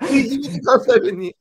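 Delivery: high, loud, laughing delivery; laughing through words. Real, not acted. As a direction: laugh while landing the punchline. Laughing at own anecdote's punchline.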